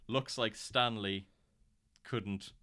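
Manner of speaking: drawn out, slowly spelling out each word; slow dramatic read. Retold or imitated. Telling friends the fortune cookie message, slowly and dramatically. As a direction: read it like a grim reveal, slowly.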